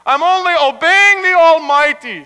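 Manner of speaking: high, loud self-justification; loud protest. Acted. Rabbi excusing his sawing to his wife, protesting loudly.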